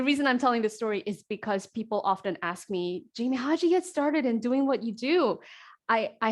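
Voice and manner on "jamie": usual speaking voice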